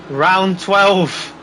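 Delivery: usual speaking voice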